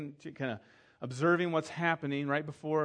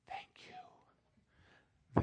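soft grateful whisper; whispered thanks; 8 dB quieter than usual. Retold or imitated. The groom thanks the pastor after rehearsal, whispered. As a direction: breathe it out in earnest gratitude.

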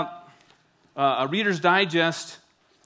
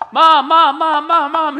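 put-on kids calling mom; 11 semitones higher, 13 dB louder, slower than usual. Kids knocking on the bathroom door, high, loud, whiny calling.

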